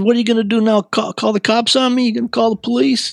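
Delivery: high-pitched run of challenging questions; retold defiant challenge. Cornered teenager to the man who grabbed him, defiant. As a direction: act out daring the captor.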